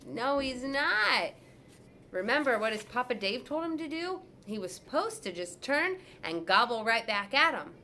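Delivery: put-on teacher pausing to quiz kids; storyteller asking listeners; reading to children. A narrator's reading. Narrator addressing children.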